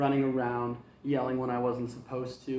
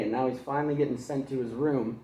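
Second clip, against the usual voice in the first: plain reading voice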